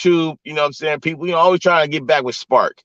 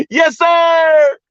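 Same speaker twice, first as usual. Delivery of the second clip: high, loud hype yell; pumped-up hype call; excited shout; 5 dB louder than usual. Real, not acted. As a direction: shout it to the chat.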